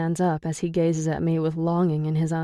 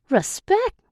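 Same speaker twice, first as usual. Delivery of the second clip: incredulous; 14 semitones higher, 4 dB louder than usual. A narrator's reading. Declan incredulous.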